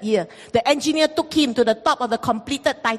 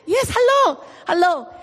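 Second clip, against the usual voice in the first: flustered surprise; 4 semitones higher than usual. Retold or imitated. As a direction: caught off guard, stammering a greeting. Flustered reply to the worker.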